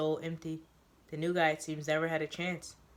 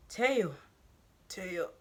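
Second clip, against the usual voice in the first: strained call; 3 semitones higher than usual. Acted. Choked newbie calls Teo's name, strained and breathless. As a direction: force his name out through a crushed throat.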